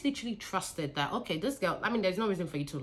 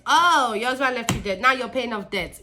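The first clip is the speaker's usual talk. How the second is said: mocking repeat; 5 semitones higher, 8 dB louder than usual. Mocking employee's excuse.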